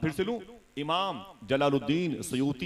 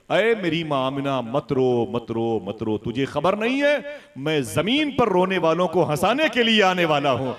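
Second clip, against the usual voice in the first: loud, slow declamation, rising in force; impassioned consoling; 2 semitones higher, 6 dB louder than usual. A narrator's reading. Unborn son tells his weeping mother not to cry, impassioned consoling. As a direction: proclaim comfort with swelling power.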